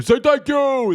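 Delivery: high, loud, whiny put-on voice; J D Vance impression. Imitating Drewby's Vance demanding thanks, high and whiny. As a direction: squeal the demand like a brat. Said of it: "his J D Vance".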